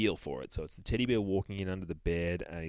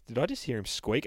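ordinary delivery

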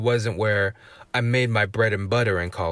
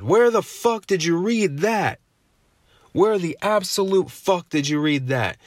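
higher-pitched, indignant demand; incredulous outrage; 7 semitones higher than usual. Real, not acted. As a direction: challenge them in real disbelief, pitch jumping up. Incredulous rant at myth believers.

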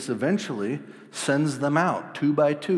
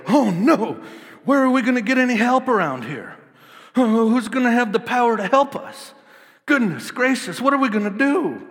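high, loud hammed-up panic; panicked; 11 semitones higher, 8 dB louder than usual. Disciples panicking in the storm, high loud cries. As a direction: wail like frightened sailors.

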